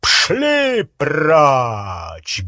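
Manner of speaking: loud, booming dismissal; thundered command. A narrator's reading. Harry sends Muggle-borns to the fireplaces, thundered command. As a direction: bark it out across the hall. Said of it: "грянул он".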